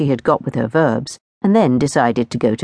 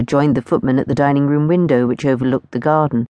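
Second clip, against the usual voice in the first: usual speaking voice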